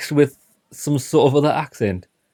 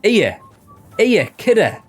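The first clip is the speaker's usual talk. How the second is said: higher, louder hammed-up Sunderland greeting; exaggerated Sunderland accent; 10 semitones higher, 5 dB louder, slower than usual. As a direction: greet like a Mackem lad on the street. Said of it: "It's like Sunderland accent".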